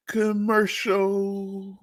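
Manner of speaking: very slow stretched word; drawn out. Pretend. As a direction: stretch out every syllable. Repeating word playfully.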